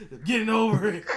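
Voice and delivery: high voice breaking into laughter; laughing mock voice. Co-host imitating a wrestler to Lance while laughing.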